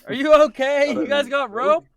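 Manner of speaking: loud, high-pitched holler; pretend yell across. Checking on the elves across the way, shouted in play. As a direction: call out as if over a distance. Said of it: "do you, like, yell across the".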